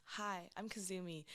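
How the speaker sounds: smooth radio host hello; podcast voice. Shown as a demonstration. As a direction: introduce yourself like a podcast host. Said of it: "this is my podcast voice".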